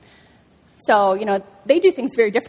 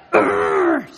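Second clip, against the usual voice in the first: effortful grunt; 12 semitones higher than usual. Retold or imitated. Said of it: "She's like".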